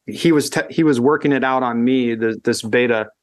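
normal talking voice

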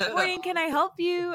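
bright, high customer service greeting; chirpy helpful shop assistant. Retold or imitated. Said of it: "service voice is two octaves up".

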